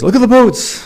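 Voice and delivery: high, eager cry with a laugh; hammed-up excited dad; excited dad exclamation. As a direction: point it out like the best thing ever. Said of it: "Although it was".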